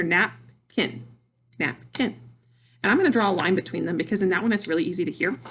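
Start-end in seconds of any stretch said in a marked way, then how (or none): 0.0-2.2 "napkin" slowly broken as nap... kin; split into syllables. Shown as a demonstration. Teacher showing two word parts, "napkin" split in two. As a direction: clap out each half of "napkin" separately. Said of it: "if I clap, I can hear".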